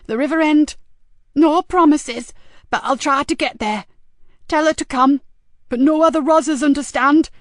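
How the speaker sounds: high, insistent female voice; jumpy caller giving a warning; agitated woman caller. A narrator's reading. Frankie sets terms for meeting.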